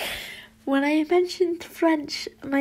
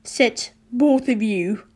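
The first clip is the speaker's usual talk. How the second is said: demanding; 6 dB louder, slower than usual. A narrator's reading. Angry father orders two students, demanding. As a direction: order them firmly and loudly. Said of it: "Mister Draco demanded".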